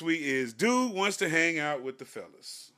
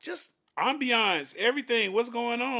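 high, emphatic self-justification; argumentative; 8 semitones higher, 4 dB louder than usual. Acted. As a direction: justify yourself loudly, pitch raised. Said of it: "felt like an argument was ensuing".

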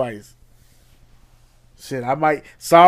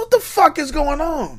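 much higher outraged disbelief; retold shock; 13 semitones higher than usual. Co-host retells his reaction to Trump, high and appalled. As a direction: ask it appalled. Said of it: "And I said".

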